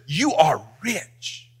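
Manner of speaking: hushed and slowed right down; quiet slow voice of Jesus to the church; soft slow Jesus quote. Acted. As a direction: let it land gently, almost a whisper of praise.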